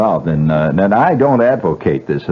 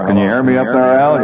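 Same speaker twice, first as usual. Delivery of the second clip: calling up to balcony; 6 semitones higher than usual. Real, not acted.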